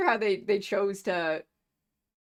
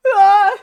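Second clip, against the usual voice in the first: sharp high scream; loud scream; 12 semitones higher, 17 dB louder than usual. Acted. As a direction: yell out in alarm. Character cries out.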